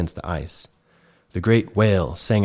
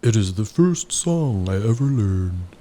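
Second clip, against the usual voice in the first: whale voice; 2 semitones higher than usual. Acted. Whale speaks.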